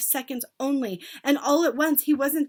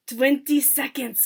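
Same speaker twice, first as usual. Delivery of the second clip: loud, slow countdown call; ringing witchy announcement; witch crying out; 3 semitones higher, 7 dB louder, slower than usual. A narrator's reading. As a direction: draw the words out loudly. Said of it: "cried the high".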